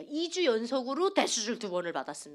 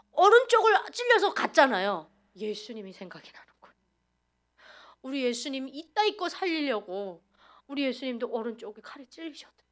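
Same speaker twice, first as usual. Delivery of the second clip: usual speaking voice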